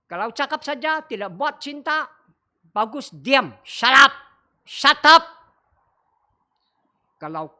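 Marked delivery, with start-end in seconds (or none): 3.7-5.3 punchy loud shut up; loud mock scold. Real, not acted. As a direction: bark it at idle talkers. Preacher scolding empty talk. (bentakan keras)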